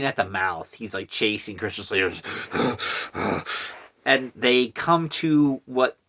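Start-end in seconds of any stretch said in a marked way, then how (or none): 2.2-3.7 harsh huffed breaths, imitated; mock rabid panting. Imitating a man foaming while chasing, mock panting. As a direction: pant like a crazed pursuer.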